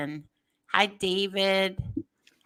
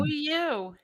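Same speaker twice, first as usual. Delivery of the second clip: higher, softer flirty-sounding greeting; sexy voice; 7 semitones higher, 5 dB quieter than usual. Real, not acted. Co-host greets arriving guest Maz in a sexy voice. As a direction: greet him softly, pitched up. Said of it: "you have a sexy voice".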